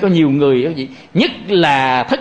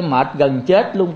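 ordinary talk

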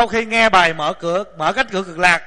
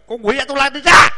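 louder, firm order to a demon; commanding exorcism; 4 semitones higher, 7 dB louder than usual. Retold or imitated. Monk driving out the anger demon, loud command. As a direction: cast the demon out with a sharp command. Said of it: "mình kêu".

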